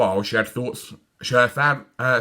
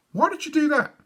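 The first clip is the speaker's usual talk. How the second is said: high questioning voice; high puzzled father. A narrator's reading. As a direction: ask in disbelief. Father asks.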